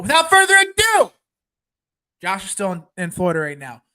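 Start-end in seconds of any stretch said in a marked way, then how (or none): 0.0-1.1 raised, high emcee call; showman's send-off. Real, not acted. Host cueing the end of the show, rousing announcement. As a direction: belt it like a ringmaster.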